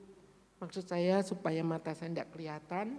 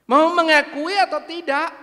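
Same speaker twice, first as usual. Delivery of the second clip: angry high shout; 8 semitones higher, 13 dB louder than usual. Retold or imitated. Church member furiously demands the pastor confess, shouting high. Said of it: "Dia marah nadanya tinggi".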